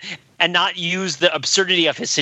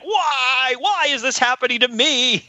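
high, whiny voice, lightly played; a hint of a melodramatic German expressionist figure; mock upset whiner; 9 semitones higher, slower than usual. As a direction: ham up a self-pitying victim wail. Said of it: "if he were more upset".

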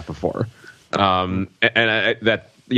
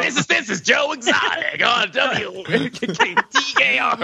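Joe Exotic impression; 13 semitones higher than usual. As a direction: shout it like a hammed-up radio host. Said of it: "Radio voice".

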